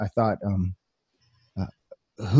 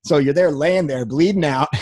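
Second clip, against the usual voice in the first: ordinary talk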